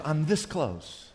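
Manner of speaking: hushed, earnest retold reply; quiet confirmation. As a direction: let the admission settle gently. Her soft confirming reply.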